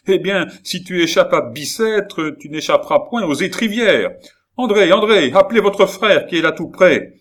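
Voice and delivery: high, loud threat turning to a call; furious shouting. Acted. Baron threatening Gilbert and calling André, loud furious shout. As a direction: rage on, then shout for the daughter. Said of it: "s'écria le baron".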